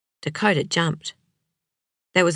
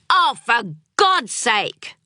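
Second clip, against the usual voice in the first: slow, raised, fed-up snap; exasperated outburst; 6 semitones higher, slower than usual. Acted. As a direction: let the frustration burst out at the table. Sam loses temper at dinner.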